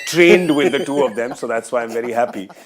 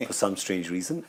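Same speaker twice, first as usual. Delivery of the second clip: usual speaking voice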